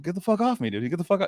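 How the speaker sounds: hammed-up annoyed friend; mock angry brush-off. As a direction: imitate a friend shoving a hugger away. Leonard pushing him away, quoted.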